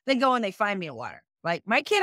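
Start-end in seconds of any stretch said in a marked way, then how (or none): none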